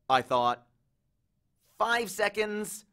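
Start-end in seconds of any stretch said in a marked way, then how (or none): 1.8-2.8 higher, drawn-out worried count; slow scared countdown. Acted. Grover warns Percy time is up, slow and scared. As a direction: stretch each word with dread.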